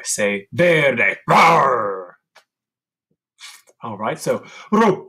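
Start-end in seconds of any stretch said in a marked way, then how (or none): 1.3-2.2 loud bear roar. Shown as a demonstration. Teacher growling like a bear for kids. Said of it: "growl like a bear".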